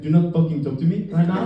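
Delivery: put-on curt French-accented rebuff; goofy French accent, refusing to chat. French kid brushing off a teammate, comic French accent. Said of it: "to the French kid, he'd be like".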